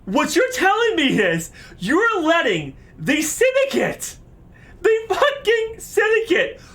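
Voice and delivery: high, incredulous shouted tirade; outraged rant. Real, not acted. Player scolding the queen over the Syndicate, outraged. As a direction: argue it out at the top of your pitch.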